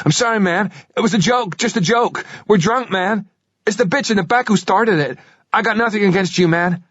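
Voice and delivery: high frightened babble; terrified pleading. Acted. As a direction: driver gabbling apologies in fear.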